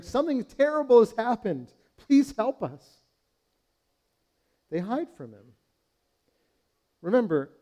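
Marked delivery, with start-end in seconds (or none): 0.0-3.0 high pleading cry, slowing at the end; desperate plea for help. Pretend. Adam and Eve begging God for help, pleading. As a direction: beg for rescue as if caught in disaster. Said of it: "and rather than running to him and saying".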